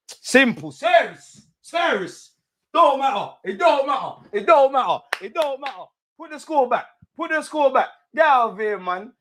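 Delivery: high, loud taunting shouts; gloating celebration. Real, not acted. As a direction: taunt the rivals in triumph. Celebrating the retaken penalty.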